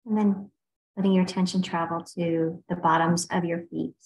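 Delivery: unhurried meditation guide with long pauses; guided meditation. Real, not acted. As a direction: ease listeners through a body scan, leaving space between phrases. Leads a body scan meditation.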